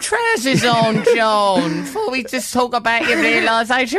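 high, loud, drawn-out mock scolding; hammed-up exasperated mum. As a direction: play an appalled mum, stretching the words.

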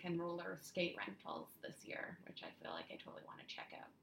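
quick unvoiced whisper. Real, not acted. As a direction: hush it like a secret. Whispered side remark.

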